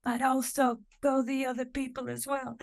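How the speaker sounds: a high, half-hearted "go England"; half-hearted cheer. Retold or imitated. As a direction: cheer without meaning it, hedging. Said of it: "I'm just like".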